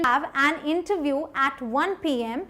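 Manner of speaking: slow, careful demo phrasing; slow, clear model line for students; slow model sentence. Shown as a demonstration. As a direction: spell it out slowly for the learner. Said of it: "पहले आप reception".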